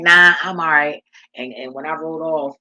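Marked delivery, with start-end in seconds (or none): 0.0-1.0 put-on boy's refusal repeated, louder and laughing; laughing imitation. Retelling how the boy turned down free water, laughing loud. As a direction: crack up while acting out the boy's no.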